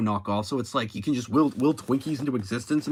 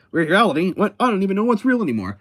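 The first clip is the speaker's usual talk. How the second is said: higher and louder, rattled disbelief; flustered editor; 6 semitones higher, 4 dB louder than usual. Retold or imitated. Jameson confused about realities, high flustered outburst. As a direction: sputter like a confused, overwhelmed boss. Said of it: "He's like".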